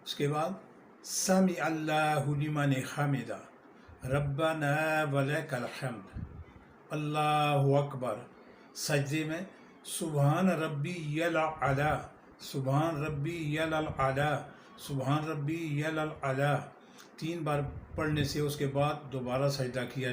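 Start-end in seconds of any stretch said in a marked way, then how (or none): 1.0-8.1 slow, measured Arabic with each word drawn out; slow correct prayer phrases; careful recitation. Shown as a demonstration. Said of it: "عربی صحیح مفہوم میں پڑھیں گے". 9.8-16.5 slow, measured سبحان ربی الاعلی with every syllable clear; careful recitation. Shown as a demonstration. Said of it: "عربی صحیح مفہوم میں پڑھیں گے".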